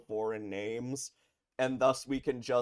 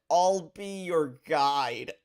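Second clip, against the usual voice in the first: mocking repeat; 6 semitones higher, 9 dB louder, much slower than usual. Shown as a demonstration. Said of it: "It's terrible".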